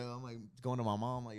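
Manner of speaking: ordinary talk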